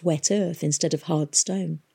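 ordinary delivery, nothing marked

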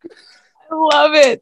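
high, drawn-out delighted squeal; thrilled delight. Real, not acted. Host reacting to guest's American accent, thrilled squeal.

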